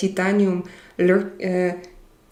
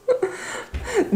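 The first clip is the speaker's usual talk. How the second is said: high laugh; 5 dB quieter than usual. Real, not acted. Laughing at a viewer's username.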